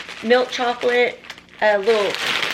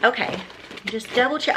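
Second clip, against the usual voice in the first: ordinary talk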